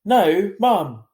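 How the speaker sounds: sharp, loud and fast refusal; quick loud no. A narrator's reading. Via quickly refusing her mother, loud. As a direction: cut her off quickly, raising the voice. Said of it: "I said quickly".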